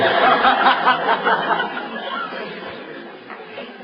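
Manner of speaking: a high, breathy burst of laughter at his own joke; big laugh. Real, not acted. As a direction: laugh out loud, high and airy.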